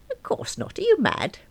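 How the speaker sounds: put-on high, huffy elderly voice; an outraged old caller; indignant old lady.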